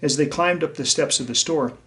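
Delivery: put-on lively read-aloud for young listeners; bright, gentle storyteller voice; story reading. A narrator's reading. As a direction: read it to the class like a picture book. Reads storybook to children.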